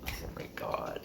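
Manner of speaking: breathless whispered disbelief; hushed oh my God. Retold or imitated. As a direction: whisper it, stunned with relief. Quiet relieved reaction to dad.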